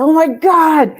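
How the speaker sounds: high, loud mock panic; nervous outburst. DJ scolding herself on her first broadcast, mock-panicked. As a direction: re-enact a loud cry of dismay. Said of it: "I was so nervous".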